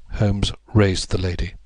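ordinary delivery

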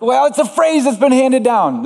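high-pitched playful self-justification; mock defensive. Preacher justifies his Latin to colleague, mock defensive.

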